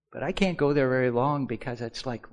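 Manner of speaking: ordinary talk, nothing marked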